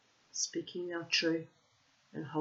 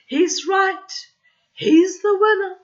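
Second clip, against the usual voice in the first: high, loud shout of joy; overjoyed man cheering the winner; delighted shout; 18 dB louder than usual. Acted. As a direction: yell it out in delight. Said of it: "Man shouted with delight".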